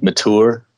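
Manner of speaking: mature as "ma-TOOR", drawn out; dad's affected pronunciation. Retold or imitated. Son imitating dad's emphatic word choice, mature said "ma-TOOR". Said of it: "he'll put emphasis in words".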